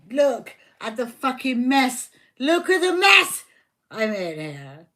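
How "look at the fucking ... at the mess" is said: angry shout. Real, not acted. Furious over messy cards.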